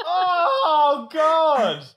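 high, loud drawn-out "oh God"; exasperated outcry. Real, not acted. Reacting to co-host liking Jax, loud outcry. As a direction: wail it out in loud dismay. Groaning at a co-host's opinion.

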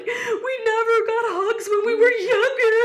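very high, squeaky crying imitation with laughter; hammed-up blubbering; mock tearful wail. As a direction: wail it like a sobbing child, half laughing. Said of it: "we're both crying".

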